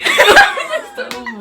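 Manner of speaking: very high, loud real laughter through the words; can't stop laughing; laughing hard. Real, not acted. Laughing while telling story.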